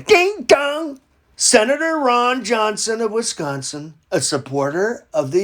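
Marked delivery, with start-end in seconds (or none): 0.1-1.0 mock doorbell. Comic doorbell.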